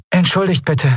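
put-on high, lovely young woman's voice; warm, sweet, desperate undertone. A narrator's reading. Lost young woman approaches a stranger, warm with desperate undertone. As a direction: plead sweetly, with despair underneath. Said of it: "trotz ihres verzweifelten Untertons so warm und lieblich".

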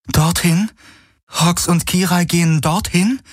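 high-pitched doubtful question. A narrator's reading. Kirai questioning where they are going, doubtful. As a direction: ask in disbelief, lifting at the end. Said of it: "fragte sie zweifelnd".

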